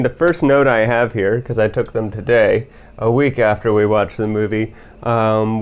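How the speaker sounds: ordinary delivery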